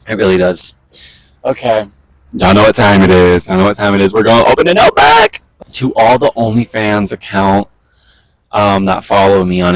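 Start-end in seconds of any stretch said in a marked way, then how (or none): 4.1-5.4 hype announcement. Real, not acted. Announcing vlog segment.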